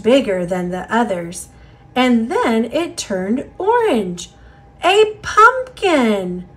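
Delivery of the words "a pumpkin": delighted exclaim. Acted. Joyful discovery.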